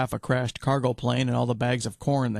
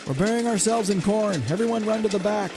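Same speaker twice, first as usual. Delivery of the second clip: high raised call to everyone; yelling; 9 semitones higher than usual. A narrator's reading. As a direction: shout orders to the group. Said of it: "yelled so everyone could hear".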